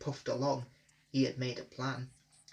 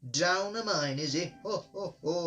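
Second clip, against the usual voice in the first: laughing; 4 semitones higher than usual. A narrator's reading. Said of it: "laughed Gordon".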